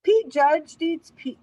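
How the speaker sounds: usual speaking voice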